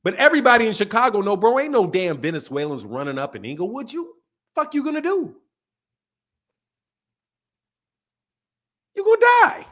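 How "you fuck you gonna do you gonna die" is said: threatening local voice. Retold or imitated. Voicing the Inglewood locals.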